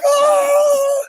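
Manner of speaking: high loud mock wail Oh; crazy dance wail. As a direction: let out a wild childish cry. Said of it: "I would just be like".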